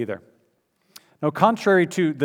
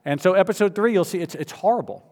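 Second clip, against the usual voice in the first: normal talking voice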